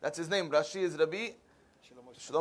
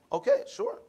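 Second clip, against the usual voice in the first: startled high agreement; 12 semitones higher than usual. Retold or imitated. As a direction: voice jumps very high. Rabbi agrees to the surprising request.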